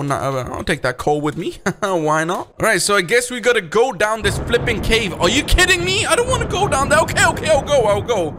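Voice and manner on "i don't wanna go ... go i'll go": ordinary delivery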